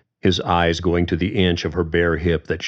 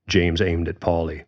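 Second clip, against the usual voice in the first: usual speaking voice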